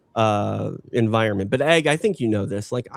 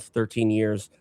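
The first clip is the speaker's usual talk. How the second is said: usual speaking voice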